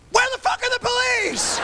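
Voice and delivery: high, panicked squeal; panicked squeak mid-riot. Retold or imitated. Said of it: "I'm just thinking".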